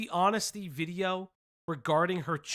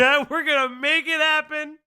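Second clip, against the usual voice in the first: higher, loud playful battle cry; mock rallying shout; 7 semitones higher, 8 dB louder than usual. Streamer rallying chat over friends' objections, mock rallying shout. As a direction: shout it to rouse the troops, hammed-up.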